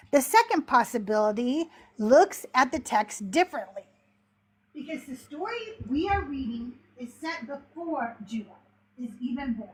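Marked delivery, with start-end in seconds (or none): 4.7-9.7 quiet. Real, not acted. Distracted by the dog, quieter.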